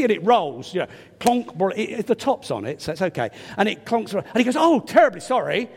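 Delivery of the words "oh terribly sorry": lightly posh English apology, raised a bit; a hint of a posh young man apologising. Preacher retelling the young man's apology, lightly posh. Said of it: "quite posh voice".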